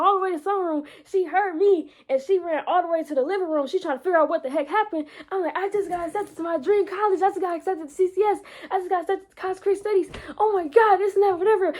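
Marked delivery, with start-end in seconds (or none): 5.5-11.7 high, fast, thrilled announcement; excited announcing. Retold or imitated. To sister, telling of the college acceptance, excited. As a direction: blurt out the big news.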